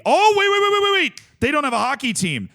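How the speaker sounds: high, loud startled stop-yourself cry; real surprise, voice jumping up then settling; sudden realization. Real, not acted. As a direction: catch the mistake mid-sentence, jolted. Suddenly catches own mistake.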